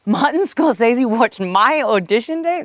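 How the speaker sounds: stunned disbelief. Retold or imitated. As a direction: ask it like you can't believe it. Retelling disbelief at Scorsese news.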